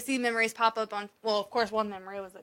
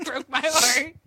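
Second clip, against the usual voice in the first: usual speaking voice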